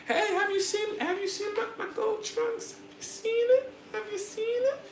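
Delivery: very high, sweet teasing voice; high girly voice.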